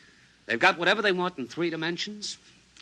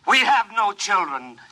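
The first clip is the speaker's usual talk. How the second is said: higher and louder, slow and emphatic; insistent outburst; 6 semitones higher, 5 dB louder than usual. Acted. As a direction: plead it forcefully, slowly. Patient insists to doctor.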